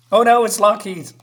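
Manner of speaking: sudden loud alarm; oh no, raise the voice in alarm; mock alarm at the danger.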